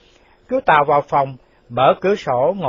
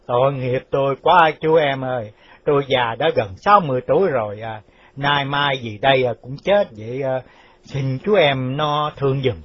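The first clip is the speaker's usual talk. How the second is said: put-on pleading old official. Quan phủ near sixty begging Chú Tàu for mercy, pleading. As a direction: beg gently for pity, dragging the words.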